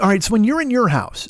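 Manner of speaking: ordinary delivery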